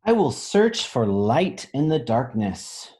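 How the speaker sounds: slow, deliberate picture-book reading; slow storybook read. A narrator's reading. Teacher reading to children, slow and deliberate. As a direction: let each word land, slowly.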